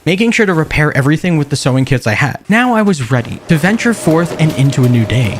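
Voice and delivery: calm documentary-style narration; measured survival-diary voiceover; story narration. A narrator's reading. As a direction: narrate the journey like a survival log.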